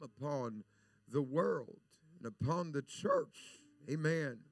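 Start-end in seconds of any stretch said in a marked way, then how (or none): none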